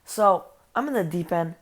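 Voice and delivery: ordinary talk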